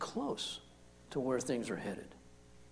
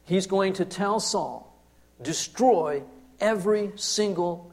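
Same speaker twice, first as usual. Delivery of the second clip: higher, louder preacher voice, slowing on key phrases; impassioned preaching; 4 semitones higher, 6 dB louder than usual. Acted. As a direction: drive it home from the pulpit. Preaching builds after the demo.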